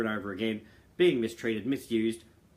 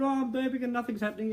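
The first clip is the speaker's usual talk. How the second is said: much higher, frantic complaint, half-acted; 13 semitones higher than usual. New father pleading with his mother, frantic. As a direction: sound at your wits' end.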